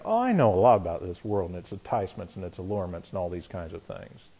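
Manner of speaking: lightly voiced know-it-all, quick and soft; smug inner voice. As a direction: brush it off like you know it all. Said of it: "be sitting there thinking".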